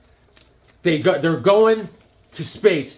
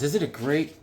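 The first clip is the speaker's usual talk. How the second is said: ordinary talk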